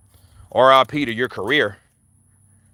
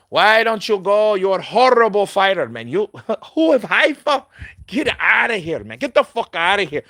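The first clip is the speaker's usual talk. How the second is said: mock Canelo; 5 semitones higher than usual. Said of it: "Now all of a sudden".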